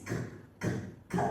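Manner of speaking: k said as a short unvoiced k sound; letter sound k. Shown as a demonstration. Teacher modelling the letter C sound, clipped k. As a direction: clip the sound k, k, k. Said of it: "This is the letter C".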